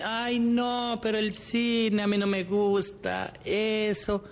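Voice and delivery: voz más aguda y lenta, de excusa desganada; excusa quejumbrosa. Retold or imitated. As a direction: pon la excusa como quien arruga la nariz. Imita la excusa del otro. (imitando al que rechaza el cine con fastidio)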